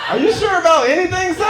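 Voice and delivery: high, loud mock disbelief; incredulous challenge. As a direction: throw a piercing question at the heckler. Mock-challenges audience member.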